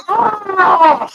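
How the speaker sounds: high, drawn-out "Oh!"; imitated pain cry. Imitating Jason getting stabbed, high pain cry. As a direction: mimic a killer stabbed in the head. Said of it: "about to get stabbed in the head".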